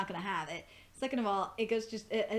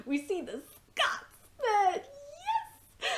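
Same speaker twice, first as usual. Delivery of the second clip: high, slow, laughing cry of joy; squealing with delight at the Scotsman; excited squeal; much slower than usual. Real, not acted. Fan squealing as the Scotsman appears, overjoyed. Excited reaction to beloved character.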